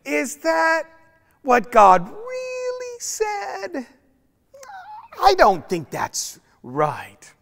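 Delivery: very high, slow, sneaky hiss of doubt; the serpent whispering doubt to Adam and Eve; sly serpent. Acted. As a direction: tempt them, slowly stretching each word. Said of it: "that snake that just kept saying".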